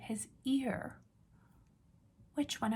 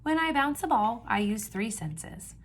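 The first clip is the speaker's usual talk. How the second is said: put-on reading to children. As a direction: read each page out to the class with energy. Teacher reads picture book aloud.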